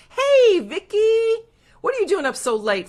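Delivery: high, loud squeal of delight; excited greeting. Real, not acted. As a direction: greet a friend with a delighted shriek. Delighted greeting of late-night viewer.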